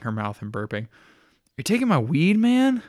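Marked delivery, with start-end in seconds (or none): 1.6-2.8 surprised. Acted. Catalina surprised, high pitch.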